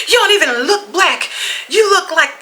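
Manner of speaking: high, mocking man's voice. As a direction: mimic him sneering up high. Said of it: "He said".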